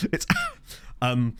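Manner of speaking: normal talking voice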